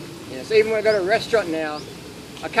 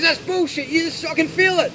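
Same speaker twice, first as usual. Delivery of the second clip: much higher, hammed-up protest; mock indignant friend; 8 semitones higher than usual. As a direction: imitate the friend refusing to believe it, pitched up. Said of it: "He goes".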